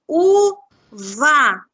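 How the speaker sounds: "Uva" loudly split u-va with a gap between; syllable by syllable. Shown as a demonstration. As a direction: sound out "Uva" in two separate, forceful beats. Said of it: "Vamos ver quantas sílabas tem?".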